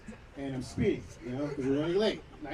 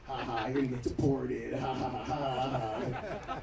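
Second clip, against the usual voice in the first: slow, drawn-out mocking ha-ha; taunting friend; 6 semitones lower, 4 dB quieter, slower than usual. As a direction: tease him like a gloating buddy. Friend keeps taunting him.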